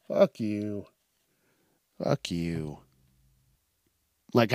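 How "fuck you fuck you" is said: low dismissive. Real, not acted. Dismissing the complainers.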